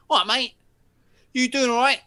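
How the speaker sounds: high, loud matey greeting; cheeky young lad. Retold or imitated. Young lad hailing the narrator outside Tesco, high and loud. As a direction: call it out like an overfriendly kid. Lad insists he knows him.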